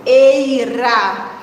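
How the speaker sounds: "eira" stretched on a long "ei"; stressed ending. Shown as a demonstration. Teacher points children to the tonic vowel, drawn out. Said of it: "não vou olhar só o ra".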